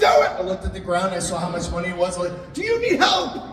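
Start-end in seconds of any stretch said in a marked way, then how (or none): 2.5-3.4 mock shocked yell. Groom yelping at guests throwing cash. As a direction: yelp it in disbelief.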